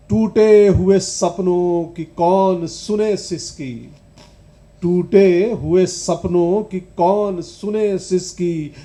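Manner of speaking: loud, slow, impassioned recital; ringing, deliberate stage recitation; declaimed poetry. Acted. Recites a poem for the audience.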